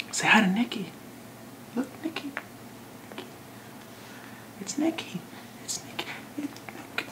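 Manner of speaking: high, soft cooing at the cat. Real, not acted. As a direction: sweet-talk the cat in a high voice. Showing the cat to the camera.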